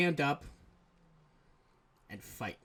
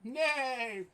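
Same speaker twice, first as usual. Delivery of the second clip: high bleating nay; goat bleat; 11 semitones higher than usual. Acted. Repeating goat nay joke.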